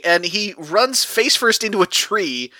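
ordinary talk

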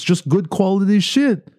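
put-on high, lightly Italian-Venezuelan accent; a quick first try at Nick's Italian-Venezuelan accent. Host first tries producer Nick's Italian-Venezuelan accent. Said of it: "As Nick would say".

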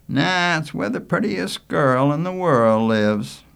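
put-on slow, loud boast to a young cousin; smitten Manley. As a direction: stretch it out proudly about your girl. Manley brags about Joy.

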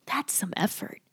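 soft breathy aside; whispering. Real, not acted. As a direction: whisper it knowingly.

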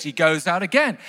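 usual speaking voice

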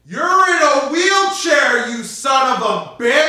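furious accusation. Acted. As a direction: blow up at the liar. Accusing in acted scene.

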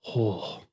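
very soft, low breath of shock; acted hushed "oh" of disbelief; stunned exhale. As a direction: let out a speechless sigh. Couple speechless in the car.

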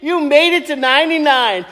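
high whooping hello to grandma; hammed-up cheer for a grandma's birthday; excited greeting. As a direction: whoop it out, thrilled to see her. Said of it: "I'm gonna go".

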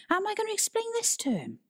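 fast, exasperated high-pitched question; quick, reproachful outburst; grilling. A narrator's reading. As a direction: demand an answer, voice shooting up. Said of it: "Toby grilled Tristan".

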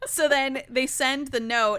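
ordinary talk, nothing marked

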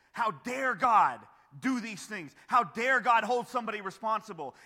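loud, high outraged protest; indignant challenge. Acted. People confronting Paul over God's justice, indignant. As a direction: throw it out as an accusation.

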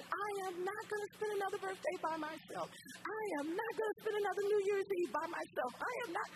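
very high, wailing refusal, acted out; tearful protest. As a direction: imitate a crying woman digging in her heels. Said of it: "why are you crying?".

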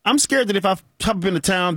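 normal talking voice

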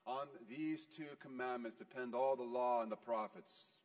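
usual speaking voice

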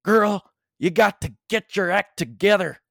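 loud, high-pitched Newfoundland telling-off; serious Newfie accent. Retold or imitated. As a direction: imitate an angry Newfie neighbor getting serious. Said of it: "in a Newfie accent".

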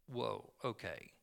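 low, slow, hushed reaction; subdued, taken aback. Retold or imitated. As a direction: take in sobering news, voice sunk low. Disciples react to news of death.

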